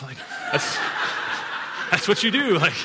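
normal talking voice

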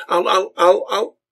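high, loud angry stammer; too enraged to finish the threat; spluttering threat. A narrator's reading. Son waving fists at old man, spluttering angrily. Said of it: "balling his fists and waving them".

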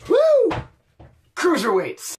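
high, loud whoop then a cheer; excited cheer. Real, not acted. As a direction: whoop it up for the cruiserweights. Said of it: "I'm very excited about the cruiserweights".

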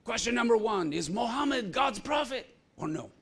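loud, high, confrontational question; demanding shout. Retold or imitated. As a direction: fire the question at him loudly. Challenger demands answer, shouted.

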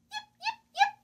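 very high yip; fox yipping. Acted. Little fox greets the duck with high yips.

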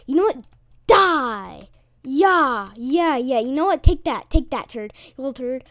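loud, punchy taunting; triumphant jeering at an opponent; gloating trash talk. Real, not acted. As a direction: taunt the enemy as you hit. Trash talk while attacking enemy.

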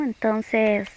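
usual speaking voice

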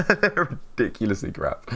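laughing through the words. Real, not acted. Streamer laughing about his own first videos, amused. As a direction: chuckle as you say it. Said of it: "said laughing".

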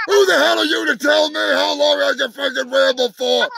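furious shout down the phone; angry yell. Acted. Ranting character shouting at his manager on the phone. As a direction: yell at the manager who cut your time.

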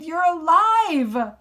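loud, high and stretched-out exclamation; joyful shout. A narrator's reading. Relieved boy to his dad in the car, shouting. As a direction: shout it with overjoyed disbelief. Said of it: "I shouted".

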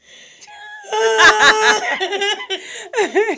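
high loud laugh-sob; laughing cry. Retold or imitated. Girl breaking down before the boyfriend, laughing sob.

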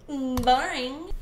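loud rising taunt; loud mocking boring. Real, not acted. Teasing the chatbot's dull answer, loud mock taunt. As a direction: sneer it.

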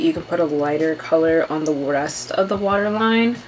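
ordinary talk

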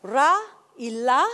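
"ra" and "la" set apart as two syllables; R versus L demo. Shown as a demonstration. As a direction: contrast "ra" against "la", each syllable on its own. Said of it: "el mismo símbolo".

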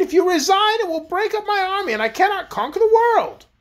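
high-pitched queenly protest; outraged royal retort. A narrator's reading. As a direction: protest as a thwarted, imperious queen. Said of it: "retorted the Queen".

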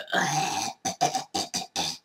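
breathy, voiceless throat clear; a dry cough to clear the croak. Real, not acted. Sam trying again to clear her throat, dry throat clear.

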